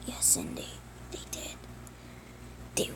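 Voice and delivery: barely voiced, faint and hurt; weak whisper. Burned brother confessing to Andy, a faint whisper. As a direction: breathe it out, too weak to speak.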